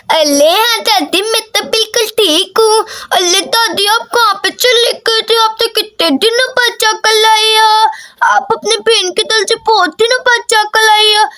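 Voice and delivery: put-on childish baby-talk lisp; lisping little girl. Daisy answers her grandmother.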